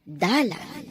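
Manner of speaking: normal talking voice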